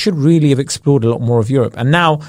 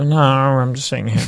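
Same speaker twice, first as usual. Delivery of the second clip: lightly voiced lazy kangaroo; voicing the kangaroo; 4 dB quieter than usual. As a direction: answer like an unbothered animal on the sofa. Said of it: "it was just like".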